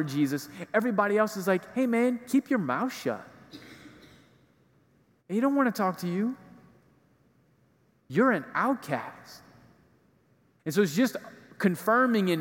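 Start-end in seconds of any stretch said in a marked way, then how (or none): 1.8-9.4 higher-pitched dismissive crowd voice; lightly acted shushing of an outcast; mock rebuke. Crowd scolding the blind beggar.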